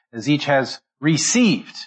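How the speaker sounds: slow build to a weighted "received"; stress on received. Shown as a demonstration. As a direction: draw out the second syllable of "received". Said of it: "notice the emphasis".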